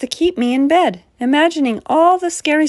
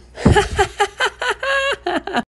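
high helpless giggling fit; burst of laughter; 12 semitones higher than usual. A narrator's reading. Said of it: "I fell back on the bed laughing".

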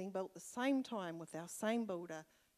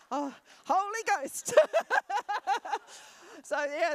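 high, loud joyful laugh in the spirit; overcome with joy; 10 semitones higher, 11 dB louder than usual. Real, not acted. Preacher overcome by the Holy Ghost, bursting into loud laughter.

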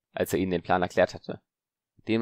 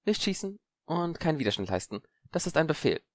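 voiced Zabini; 8 semitones higher than usual. Zabini giving orders.

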